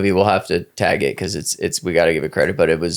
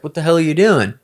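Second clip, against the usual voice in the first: higher, incredulous question; 8 semitones higher than usual. Retold or imitated. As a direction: play it baffled that his friend jumped in. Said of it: "And the guy's like".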